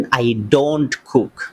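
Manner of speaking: each word drawn out slowly for learners. Shown as a demonstration. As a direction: spell it out slowly like a teacher. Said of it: "আমি খুব আস্তে আস্তে বলছি". (খুব ধীরে)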